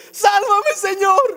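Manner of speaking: agudo y exclamado, como quien se hunde; grito con signos de admiración. Shown as a demonstration. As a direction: grita pidiendo auxilio con la voz muy arriba. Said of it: "dice signos de admiración, sería algo como". (clamor agudo y desesperado, actuado)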